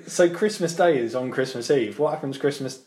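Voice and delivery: usual speaking voice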